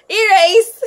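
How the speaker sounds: high, loud, giggly blurt; real amused outburst; laughing. Real, not acted. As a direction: break into giggles over a slip. Laughs at own slip while reading.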